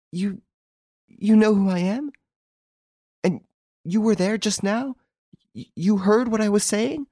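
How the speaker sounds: high, halting, stupefied questions; stammering. A narrator's reading. Girl facing two strangers who overheard her, stammering. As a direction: falter and restart in a high voice. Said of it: "she stammered".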